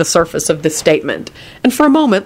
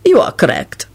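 put-on lofty, accented declaration; supercilious; 3 semitones higher than usual. A narrator's reading. Radu accepts deference as his due, superciliously. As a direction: agree as if granting a favour. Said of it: "Radu declared superciliously".

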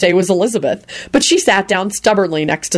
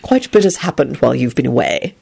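truthful; 6 semitones lower than usual. A narrator's reading. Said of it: "Quentin told her truthfully".

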